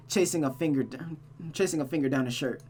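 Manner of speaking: usual speaking voice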